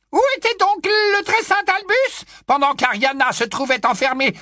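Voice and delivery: put-on high, loud old busybody voice; high loud tipsy old aunt gushing; old aunt Muriel.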